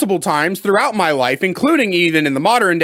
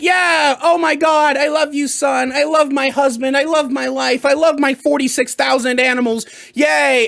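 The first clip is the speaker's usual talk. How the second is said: higher, gushing mom voice, over-the-top cheerful; mock happy-go-lucky; 5 semitones higher than usual. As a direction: play the mother beaming with love for everything. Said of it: "happy and go lucky and be like".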